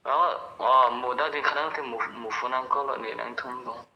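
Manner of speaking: ordinary talk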